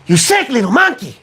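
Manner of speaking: high, strained Ren from Ren and Stimpy; shrill cartoon chihuahua rant; Ren impression. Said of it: "sounds like Ren from Ren and Stimpy".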